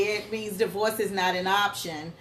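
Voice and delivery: usual speaking voice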